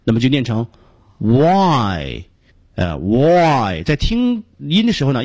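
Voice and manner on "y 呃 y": long "Y" as w plus open "ai"; stretched model "Y"; lowered-tongue diphthong. Shown as a demonstration. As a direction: drop the tongue through a drawn-out "Y". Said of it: "应该明显感到舌面在下降".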